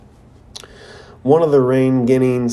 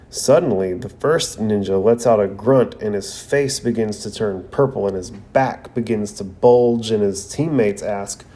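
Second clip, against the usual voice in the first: ordinary talk, nothing marked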